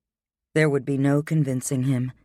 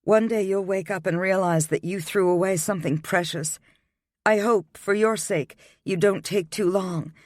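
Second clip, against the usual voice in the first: put-on higher, bewildered young woman protesting; 6 semitones higher than usual. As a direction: push back, hurt and confused, pitched high.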